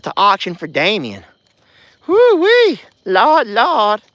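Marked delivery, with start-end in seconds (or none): 2.1-4.0 high, loud whoop; hooting excitement. Real, not acted. Vlogger whooping about Damien, high and loud. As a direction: hoot like a smitten fan.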